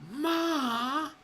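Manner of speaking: high, long drawn-out call for Ma; whiny holler to mother in another room; mock calling out. Streamer calling mother from other room, mock whiny yell.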